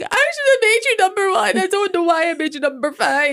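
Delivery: whiny sobbing kid, hammed up; mock crying child. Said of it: "and then he starts crying".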